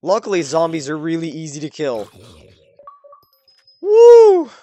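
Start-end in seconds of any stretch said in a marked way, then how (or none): 3.8-4.5 loud high victory whoop; excited whoop. Real, not acted. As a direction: whoop in triumph. Zombies killed.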